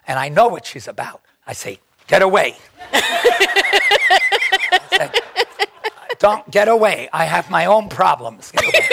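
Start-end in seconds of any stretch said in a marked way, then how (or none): 2.1-8.9 louder, laughing get away; laughing shoo-off. Retold or imitated. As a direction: wave her off through laughter. Shooing her off, laughing.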